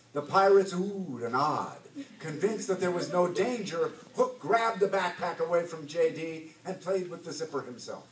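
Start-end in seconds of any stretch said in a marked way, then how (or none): none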